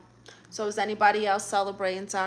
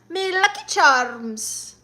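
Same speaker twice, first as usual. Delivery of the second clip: high, slow mock Irish catchphrase; badly faked Irish accent; terrible Irish accent; 4 semitones higher, 4 dB louder, slower than usual. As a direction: stretch it out in hammed-up bad Irish. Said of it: "It's a terrible Irish accent".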